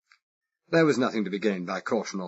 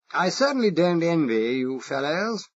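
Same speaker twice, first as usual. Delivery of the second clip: exaggerated posh English accent; 7 semitones higher, 4 dB louder than usual. Spy asking the Irish foreman, exaggerated posh English accent. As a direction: play the harmless toff with a marked English accent. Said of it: "I exaggerated it".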